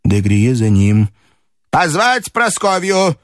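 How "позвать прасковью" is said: put-on high, slow old-lady order; commanding grandmother. A narrator's reading. Grandmother ordering maid to fetch Polina, high command. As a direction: issue the summons deliberately, pitched high. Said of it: "велела бабушка Марфе".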